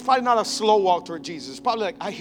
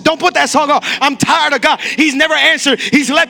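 higher, shouted complaints of a fed-up believer; loud bitter refusal; 6 semitones higher, 7 dB louder than usual. Acted. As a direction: yell out the hurt of someone who quit on God. Churchgoer refusing to go, acted.

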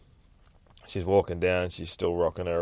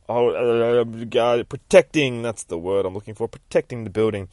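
plain reading voice

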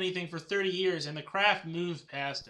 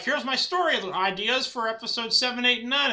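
George Lucas whine; 5 semitones higher, 6 dB louder than usual. Retold or imitated. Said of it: "that's my George Lucas whining voice".

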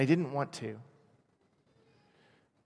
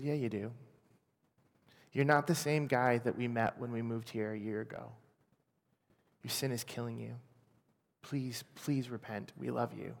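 quiet, earnest plea, much softer; gently begging him to turn back; soft pleading; 7 dB quieter than usual. Retold or imitated. Pastor's wife pleading with him.

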